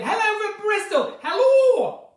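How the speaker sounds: high, loud Bristol accent greeting; attempted Bristol accent. Shown as a demonstration. Taking an accent request, loud high Bristol accent attempt. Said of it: "Give me an accent, I'll do it".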